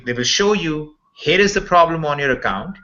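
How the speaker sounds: ordinary delivery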